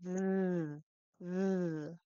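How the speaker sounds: voiced v demo; voiced v sound. Shown as a demonstration. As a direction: buzz a long v, teeth on lip. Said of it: "and then do the".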